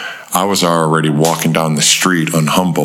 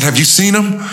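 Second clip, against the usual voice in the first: casual counter-question. Retold or imitated. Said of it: "immediately flipped the switch".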